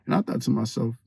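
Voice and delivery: ordinary talk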